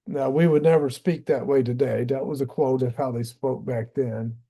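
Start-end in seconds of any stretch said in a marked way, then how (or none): none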